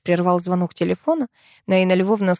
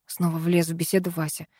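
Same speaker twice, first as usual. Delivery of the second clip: plain reading voice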